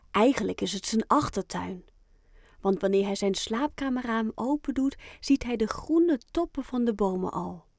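plain reading voice

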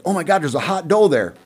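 mock-excited exclamation; excited buck. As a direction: blurt it like a buck spotting a doe. Acting out a deer's reaction.

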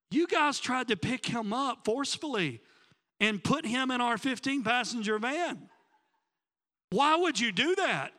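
high-pitched, incredulous scolding; aghast. Retold or imitated. Pastor confronting the two guys who grabbed him, aghast. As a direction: reenact a disbelieving confrontation.

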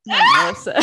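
high, loud laughter while choking. Real, not acted. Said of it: "I'm sorry, I choked on my".

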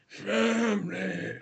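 very slow, stretched word; mock-dramatic slow delivery; drawn-out catchphrase. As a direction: draw the words right out for a joke.

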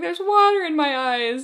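high-pitched mock distressed cry; imitating a flustered kid in a canoe; mock distressed complaint. Imitates Oscar complaining amid frantic splashing.

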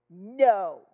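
loud defiant no, imitating a child; mock kid's yell of refusal; child's defiant refusal. Child refuses therapist in retold scene.

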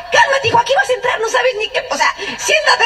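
high, giggling mock scolding; laughing scold. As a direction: tell him to sit down through laughter. She scolds her husband, laughing.

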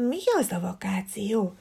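unvoiced whisper; whispered to himself. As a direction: breathe the question to yourself. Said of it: "tűnyögte maga elé".

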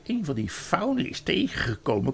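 lilting queen, jumping high on key words; scheming queen. Acted. Queen warns Edmund about faun tales, scheming and lilting. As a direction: confide with exaggerated, swooping concern.

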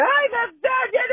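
high, loud, slow mock whine; hammed-up whining of an offended woman. Host mocking the whining woman, shrill whine. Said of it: "just whining".

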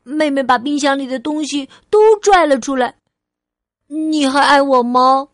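put-on eager childlike questioning; little girl Ali, testing. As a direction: play a small child posing a what-if. Child character asks mother.

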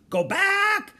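very high, loud bird cry; parrot squawk. A narrator's reading. Parrot warning those below, squawking. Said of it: "squawked Polly".

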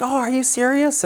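high, delighted imitation of the lady saying "serious"; mock surprised lady. Retelling the lady at Walmart's delight, high and surprised. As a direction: ham up her amazement with a lifted "Oh".

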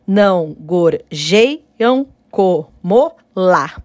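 "Não gorjeiam como lá" slowly, stopping at stressed "lá"; scanning slowly. Shown as a demonstration. Teacher to students, scanning the last verse slowly. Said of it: "Sete também".

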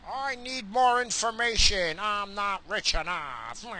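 high, slow, whiny mock voice; hammed-up high, dragging voice; mocking politician impression. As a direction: draw it out as a mocking, self-pitying complaint. Mimicking Pelosi phoning tech companies.

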